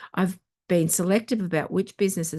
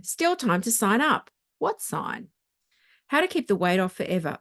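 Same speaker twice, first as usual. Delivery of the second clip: normal talking voice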